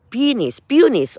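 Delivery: peonies, peonies said first as PEE-nees then as PYOO-nees, testing each; trying two pronunciations. Shown as a demonstration. Gardener unsure how to say peonies, tries both ways. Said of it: "or we call it peonies".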